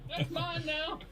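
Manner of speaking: high laughing greedy prospector; mock prospector. Grabbing gold in a museum, high mock prospector. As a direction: crow over the find, laughing.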